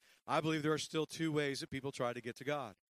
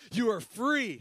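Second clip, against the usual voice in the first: high, loud ringing proclamation; proclaimed declaration; 7 semitones higher, 7 dB louder, slower than usual. Acted. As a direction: announce freedom from the pulpit.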